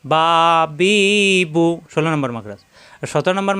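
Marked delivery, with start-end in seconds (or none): 0.0-1.7 "বা বি বু" with each vowel held long; long, sustained vowels on "বা বি বু"; drawn-out vowels. Shown as a demonstration. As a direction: stretch every syllable of "বা, বি, বু". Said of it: "এক আলিফ টেনে পড়তে হয়".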